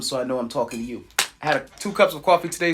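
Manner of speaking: rapid caffeinated talk; talking very fast. Real, not acted. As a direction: rush the words, wired on coffee. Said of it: "so if I'm talking very fast".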